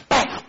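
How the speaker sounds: sudden high-pitched bam; a sharp high mock bam; bam sound effect. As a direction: punch out the slingshot noise. Preacher acting out slingshot shot.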